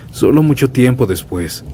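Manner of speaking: ordinary delivery, nothing marked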